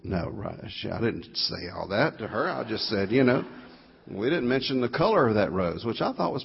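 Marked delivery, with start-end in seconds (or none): none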